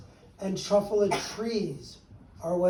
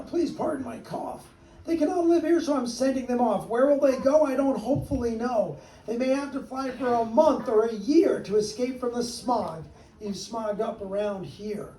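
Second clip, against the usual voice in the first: the Lorax explaining why his creatures must leave; worried Lorax; 4 semitones higher than usual. Acted. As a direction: plead in a high little voice. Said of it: "said the Lorax".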